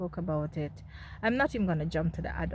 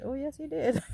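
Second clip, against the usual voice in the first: ordinary talk, nothing marked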